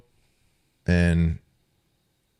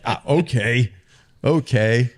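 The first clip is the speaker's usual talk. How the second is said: normal talking voice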